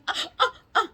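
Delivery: rapid high, loud "ah ah ah"; pained yelps. Retold or imitated. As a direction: imitate uncontrollable yelping during a procedure. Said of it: "I just kept doing this".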